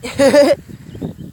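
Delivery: high-pitched acted giggle. A narrator's reading. Woman pleased with her pink explosion, giggling. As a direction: giggle high after the line. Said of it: "The woman giggled".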